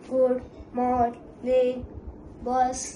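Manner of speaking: slow, even machine greeting; robot voice. Acted. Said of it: "हा मला good morning boss म्हणतो".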